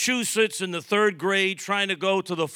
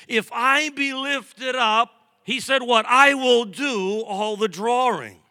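raised, slow emphatic preaching; 5 semitones higher, 5 dB louder than usual. Acted. Preacher quoting Jesus to the congregation, emphatic preaching. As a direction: proclaim the verse slowly with a lifted voice.

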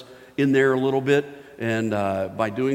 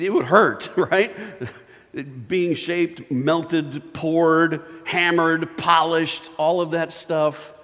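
higher, louder preacher punching each word; building preaching; 6 semitones higher, 5 dB louder, slower than usual. Acted. Preacher to his congregation, rising to full force. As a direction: hammer the list out at full force.

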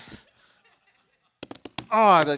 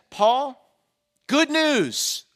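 high, bright-news announcement to Paul; playful wrong take of the angel, upbeat and high; mock cheery angel; 6 semitones higher, 4 dB louder, much slower than usual. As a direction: sell the wrong version with a beaming high lift. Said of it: "That's not what he says".

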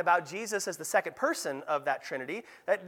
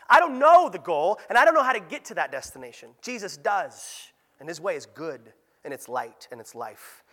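usual speaking voice

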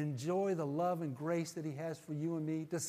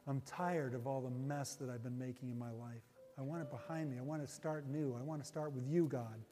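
low, soft, unhurried prayer lead; soft guided prayer; 4 semitones lower, 6 dB quieter than usual. Real, not acted. Pastor leading listeners to receive Christ, soft prayer voice. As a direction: lead them gently through the prayer.

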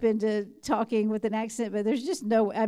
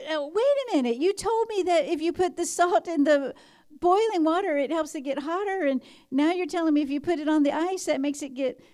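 put-on high, quick, incredulous kid's voice; indignant grandson; 6 semitones higher, 3 dB louder than usual. Boy challenging granny's contradiction, high and incredulous. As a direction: play the boy catching granny out, pitch climbing.

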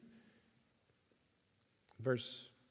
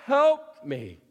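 loud, raised cry for help; kneeling plea; 8 semitones higher, 6 dB louder, much slower than usual. Retold or imitated. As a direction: implore as the woman at his feet. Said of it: "came and knelt before him, saying".

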